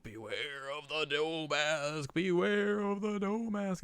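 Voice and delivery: slow, drawn-out sleeper's moan; sleep talking. Acted. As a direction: drone a warning as if dreaming. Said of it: "he's, like, sleep talking".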